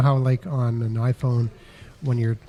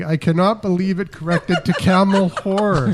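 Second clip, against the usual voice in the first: higher, louder, laughing; laughing while reading; 4 semitones higher, 4 dB louder than usual. Real, not acted. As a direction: read it through laughter.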